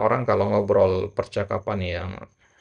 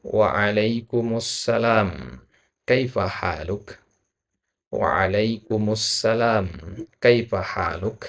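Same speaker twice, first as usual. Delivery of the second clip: slow model reading; much slower than usual. Shown as a demonstration. Teacher models reply slowly.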